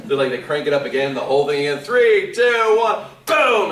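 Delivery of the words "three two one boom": rising, loud countdown ending in boom. Retold or imitated. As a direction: count it down to the blast. Retold countdown before goo cannon.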